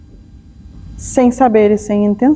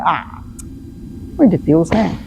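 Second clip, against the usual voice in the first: a touch of exasperated sarcasm, quieter; snappy irritated retort; 5 semitones lower, 4 dB quieter than usual. Retold or imitated. Imitating an irritated friend snapping back at a loved one, exasperated. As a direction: brush it off impatiently, mildly annoyed. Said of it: "responder de maneira atravessada".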